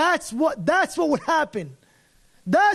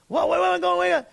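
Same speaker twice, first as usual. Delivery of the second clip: high startled protest; mock panic; 6 semitones higher than usual. Arrested driver protesting to the police, high mock panic. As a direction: act caught off guard.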